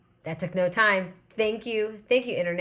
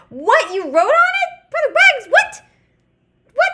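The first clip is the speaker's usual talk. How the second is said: much higher and louder disbelieving shrieks; shocked outburst; 12 dB louder than usual. Real, not acted. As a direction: yelp it out in real astonishment. Chat says he wrote the show.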